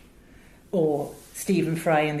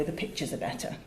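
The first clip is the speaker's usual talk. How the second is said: quiet aside; 9 dB quieter, faster than usual. Shown as a demonstration. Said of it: "we have this saying in radio".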